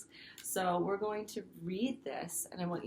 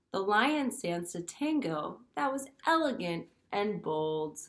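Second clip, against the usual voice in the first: lively storybook read-aloud; reading to children; 4 dB louder than usual. Acted. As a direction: read it like story time for little kids. Teacher reads picture book aloud.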